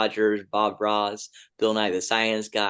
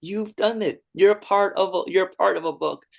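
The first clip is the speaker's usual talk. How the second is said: higher, excited praise; joyful cheer; 9 semitones higher, 4 dB louder than usual. Retold or imitated. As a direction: celebrate the reader out loud. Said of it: "is saying".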